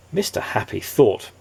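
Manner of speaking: usual speaking voice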